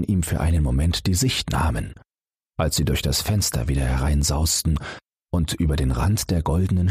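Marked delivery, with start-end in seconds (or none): none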